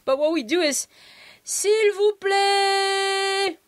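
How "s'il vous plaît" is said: high, drawn-out call for attention; comic sing-out to get the waiter over. Shown as a demonstration. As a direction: stretch each phrase long and high to summon a waiter. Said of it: "That kind of tone".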